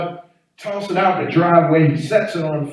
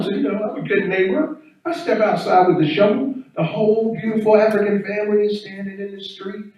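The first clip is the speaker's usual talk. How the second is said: ordinary delivery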